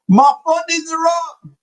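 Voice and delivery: high gleeful boast; excited brag. Real, not acted. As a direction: sing out the good news. Bragging about green accounts.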